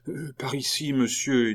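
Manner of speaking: a touch of nervous deference; trembling jailer. A narrator's reading. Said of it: "Un geôlier tremblant". (geôlier un peu craintif, voix haute)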